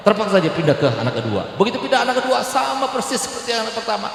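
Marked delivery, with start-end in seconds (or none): 1.6-4.1 high, urgent, fast sermon climax; impassioned preaching climax; preaching at full force. Acted. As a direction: drive the story up to its peak. Preacher climaxes the sad story.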